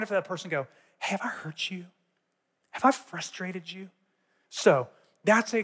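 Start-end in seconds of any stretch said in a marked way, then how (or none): 1.0-3.9 soft, careful check-in; acted-out quiet apology opener; gentle asking. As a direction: drop to a hushed, tentative question. Models gently approaching a hurt person.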